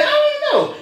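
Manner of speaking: high, bewildered mother voice; a mother shrugging off the question, pitched high; the mother asked why, answering high and baffled; puzzled mother. Retold or imitated. Mother voiced in chicken story.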